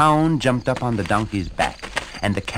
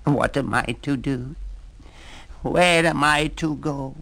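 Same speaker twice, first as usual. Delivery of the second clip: cat voice; 6 semitones higher than usual. Acted. Cat's lament.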